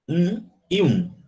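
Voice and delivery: normal talking voice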